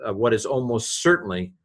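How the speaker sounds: normal talking voice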